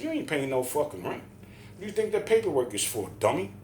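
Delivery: heated put-down from a retold argument; retold scornful rebuttal. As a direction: throw his claim back at him. Retold argument over rent.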